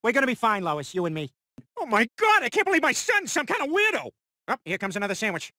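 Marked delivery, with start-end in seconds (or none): 1.8-4.1 shocked outburst. Acted. Parent shocked by son's behaviour.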